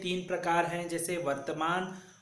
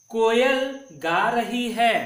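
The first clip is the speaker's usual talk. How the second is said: slow, higher and louder announcement; emphatic model sentence; 5 semitones higher, 7 dB louder, slower than usual. Shown as a demonstration. Teacher reads picture sentence loudly and slowly. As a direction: proclaim it slowly to the class. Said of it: "model sentence read to class".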